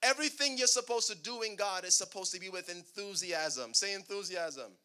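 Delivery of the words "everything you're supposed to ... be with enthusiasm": usual speaking voice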